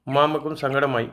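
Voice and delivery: ordinary delivery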